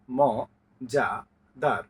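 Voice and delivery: মজাদার broken into ম, যা, দার with pauses; syllable split. Shown as a demonstration. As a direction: chop the word into its three syllables. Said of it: "Bangla শব্দাংশেও প্রত্যেকটিতে". (মজাদার শব্দাংশে ভেঙে বলা)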